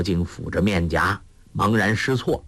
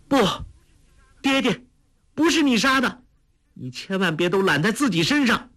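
much higher, pleading daughter's cry to her father; young woman calling out; 12 semitones higher, faster than usual. A narrator's reading. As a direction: call out like the daughter, begging. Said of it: "一个女子声音叫道". (少女焦急呼喊爹爹)

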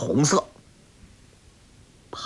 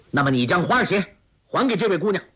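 higher, quick, mild shout; stern shout; 7 semitones higher, faster than usual. A narrator's reading. Guo Jing angrily demands the shoe back, mildly shouted. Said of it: "纵身过去喝道".

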